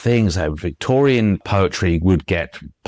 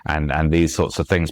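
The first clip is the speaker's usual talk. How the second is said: usual speaking voice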